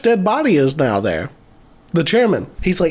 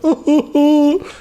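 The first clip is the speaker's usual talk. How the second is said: high hooting fake sobs; hammed-up high-pitched boo-hoo; mock crying; 10 semitones higher, slower than usual. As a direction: imitate a man wailing "ooh". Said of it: "He's crying and shit".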